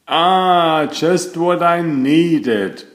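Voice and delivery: slow, content sigh of relief in a character voice; relieved sigh. Acted. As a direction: sink into the warm water and let it out slowly. Relaxing in the warm lagoon.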